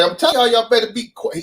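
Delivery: half-voiced Judge Mathis-like teacher; Mister Oliver voice. Gym teacher warning his class, lightly imitated. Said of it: "he sound just like Judge Mathis".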